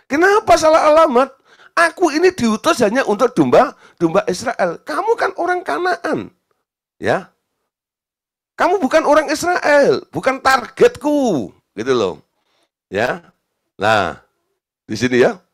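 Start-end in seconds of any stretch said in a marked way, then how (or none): 0.1-6.3 high, loud protesting explanation; emphatic, high-pitched objection; acted rebuff. Acts out Jesus rebuffing the woman. 8.5-11.5 high, emphatic refusal; raised-pitch, insistent dismissal; acted rejection. Acts out Jesus rejecting the woman.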